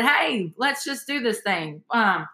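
ordinary talk, nothing marked

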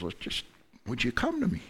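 tender invitation. Acted. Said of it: "and God says".

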